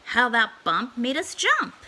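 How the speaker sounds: ordinary delivery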